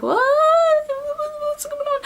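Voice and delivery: very high, mock-confused squeak; baffled onlookers. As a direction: squeak it out, imitating bewildered onlookers.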